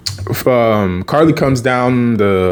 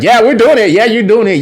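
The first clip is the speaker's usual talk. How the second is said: higher, louder, pushy enthusiasm; retold insistent cheer; 10 semitones higher, 4 dB louder, faster than usual. Sam insists they're doing it, insistent. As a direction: overrule them with mock gusto.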